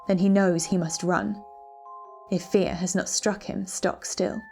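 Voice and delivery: ordinary delivery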